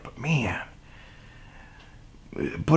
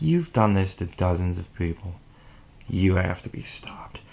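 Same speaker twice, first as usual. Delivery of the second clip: low, hushed, grave accusation; quiet confrontation; 9 semitones lower, 9 dB quieter than usual. Acted. Imagined victim confronting a serial abuser, low and quiet. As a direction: drop low and soft to confront them.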